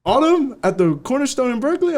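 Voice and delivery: can't believe the offer; excited disbelief. Retold or imitated. Stunned by Autumn show offer.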